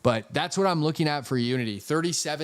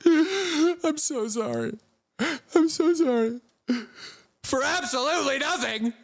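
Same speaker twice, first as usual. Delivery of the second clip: high, slow mock apology; sarcastic apology; 13 semitones higher, slower than usual. Streamer mocking his own apology to a hater, high and slow. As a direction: drag out the fake sorry. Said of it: "I'm like".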